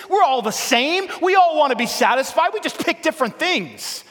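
high, loud, driving preacher's cry; fiery sermon climax; preaching at full force. Acted. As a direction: hammer it home to the crowd. Preacher rising again.

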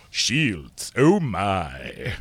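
put-on voice, dropped back from a shout; drawn-out, hammed-up impression; Sulu. Co-host mocked as Sulu plays along, low-key impression. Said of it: "You sound like Sulu".

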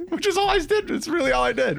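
high squeaky laughing protest; laughing, high protest of innocence. Real, not acted. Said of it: "laughing protest".

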